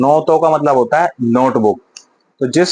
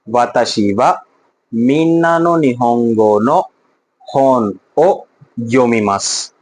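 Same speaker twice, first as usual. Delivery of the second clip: slow model sentence; slower than usual. Shown as a demonstration. Teacher models Japanese sentence.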